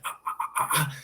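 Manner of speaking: breathless, quiet pained gasps under a burning grip; mock pained whimpering gasps. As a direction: gasp softly as the hand squeezes. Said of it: "I just feel like".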